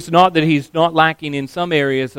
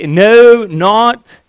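"no, not" hit hard, higher and louder; emphatic no; 6 semitones higher, 8 dB louder than usual. Shown as a demonstration. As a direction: punch "no" and then "not".

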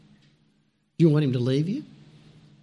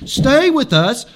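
loud, raised-pitch insistent appeal; emphatic, louder quoted plea; urging; 5 semitones higher, 7 dB louder than usual. A narrator's reading. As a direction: urge them firmly, hitting the first word. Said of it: "But they urged him".

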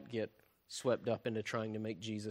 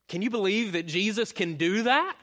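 amazed; 7 semitones higher, 8 dB louder than usual. Retold or imitated. Disciples' amazed reaction voiced.

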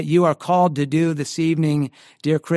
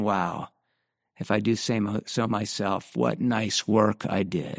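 mock smug boast; 6 semitones lower, 7 dB quieter than usual. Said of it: "It'd be preposterous".

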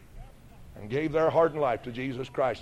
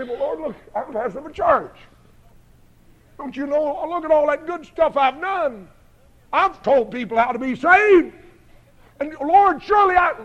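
rising higher and louder into a desperate plea; protesting to God; 11 semitones higher, 8 dB louder than usual. Retold or imitated. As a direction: argue your case to God, building. Arguing with God, pleading.

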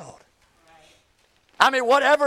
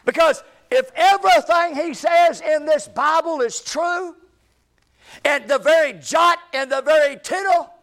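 raised, forceful pulpit cry; urgent preaching warning; 4 semitones higher, 4 dB louder than usual. Real, not acted. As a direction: warn the congregation with full force. Warns congregation at full force.

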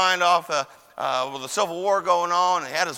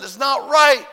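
high, loud shout of protest; outraged cry; 6 semitones higher, 6 dB louder, slower than usual. Retold or imitated. Preacher protesting whipped children, outraged shout.

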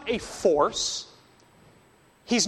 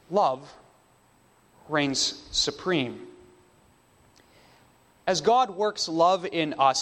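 ordinary talk, nothing marked

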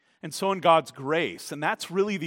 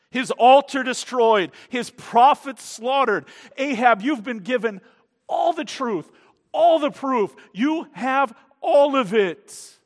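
higher and louder, driving each phrase; full-force preaching; 8 semitones higher, 9 dB louder than usual. Acted. Preacher confronting Ahab with the evidence, fervent and loud. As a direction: thunder the proof home.

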